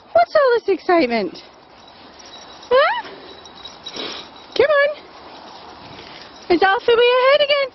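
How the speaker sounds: high, excited coaxing voice; doggy talk. Real, not acted. Owner calling excited dogs along the woods, high coaxing. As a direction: gee the dogs up and calm them.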